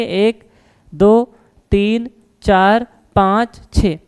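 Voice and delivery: drawn-out counting while filling the shell; slow counting. Real, not acted. Teacher slowly counting electrons for students. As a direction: count each one out slowly.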